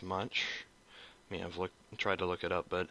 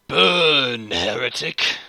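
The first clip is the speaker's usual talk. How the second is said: high, loud shouted war cry; space marine battle cry; 3 semitones higher, 12 dB louder, much slower than usual. Shown as a demonstration. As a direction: roar it at the heretic. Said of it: "I'll go show you guys what I did".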